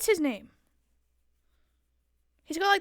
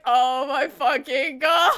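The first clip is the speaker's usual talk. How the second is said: slow, loud, laugh-strained groan; drawn-out disbelief mid laugh; imagined exasperated outburst; laughing exasperation; 7 dB louder, much slower than usual. Retold or imitated. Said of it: "I'd be like".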